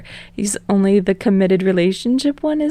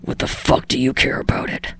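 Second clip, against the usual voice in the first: lower, softer growling Bakugo voice; snarled; 7 semitones lower, 4 dB quieter than usual. Acted. Bakugo answering Deku's call, low snarl.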